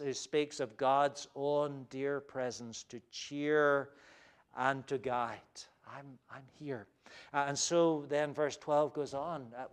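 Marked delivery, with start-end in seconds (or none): 5.9-6.9 soft reassurance. Acted. God's presence voiced softly.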